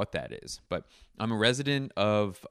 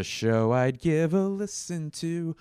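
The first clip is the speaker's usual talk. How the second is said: high silly voice; 8 semitones higher, 4 dB louder, slower than usual. Pretend. High mock voice.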